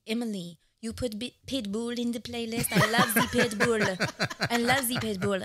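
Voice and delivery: put-on French mom accent, loud and laughing; impression of mom. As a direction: channel her lively French mother. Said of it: "That's a spot on, uh, impression of mom".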